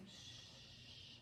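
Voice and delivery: soft unvoiced shh; shushing. Real, not acted. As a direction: hush the dog gently. Hushing the dog.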